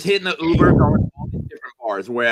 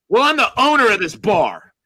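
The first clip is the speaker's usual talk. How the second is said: higher, emphatic declaration; mock indignant drunk; 7 semitones higher, 4 dB louder than usual. Drunk bar owner pulling rank on a customer, mock indignant.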